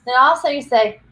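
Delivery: ordinary delivery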